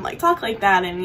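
ordinary talk, nothing marked

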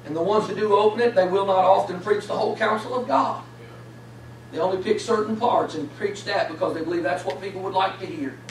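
quiet earnest preacher leaning in close; hushed preaching. Acted.

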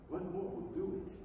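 ordinary delivery

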